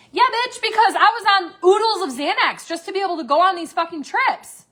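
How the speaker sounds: loud, high-pitched indignant outburst; indignant comeback. Real, not acted. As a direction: fire back loudly at the gossip. Indignant comeback to reported gossip.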